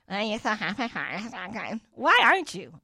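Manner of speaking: snide, slow mocking "so happy"; mock snide accusation; stank tone. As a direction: sneer it like a backhanded jab. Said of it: "say it in a stank way".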